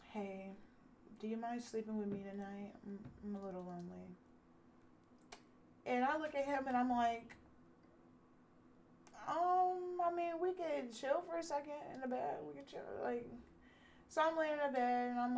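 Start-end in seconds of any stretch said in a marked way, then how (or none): 0.1-4.2 soft, quick, half-swallowed request; sheepish lonely plea. Retold or imitated. Man asking her to share his bed, sheepish plea. 9.3-13.3 reluctant hesitation. Retold or imitated. Woman answering his bedtime request, reluctant hesitation. Acting out her reluctant, awkward reply.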